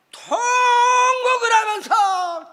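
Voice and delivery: loud, high, long-drawn first syllable; slow, swelling emphatic shout; dramatized wailing emphasis. Retold or imitated. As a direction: stretch it out like a preacher's cry. Preacher dramatizes wailing in prayer.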